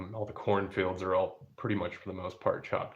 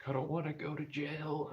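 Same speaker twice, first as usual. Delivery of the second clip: put-on much higher, softer worried kid voice; mock the fretting boy, pitched up; whiny stepson; 8 semitones higher, 6 dB quieter than usual. Dad imitating his upset stepson afraid of jail, high and whiny. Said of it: "He's like".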